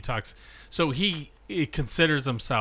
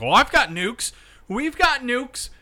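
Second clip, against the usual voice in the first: high, loud one-upmanship; taunting playground-style boast; mock boasting; 9 semitones higher, 5 dB louder than usual. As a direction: play a bragging nation, hammed-up and high. Mimics eighties nuclear bragging between nations.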